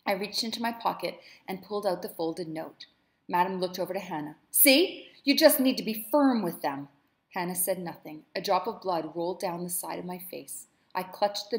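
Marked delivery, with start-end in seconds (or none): 4.5-6.9 loud, higher self-satisfied lecture; smug triumph. Acted. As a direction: gloat over the beaten girl. Madam lectures the servant smugly.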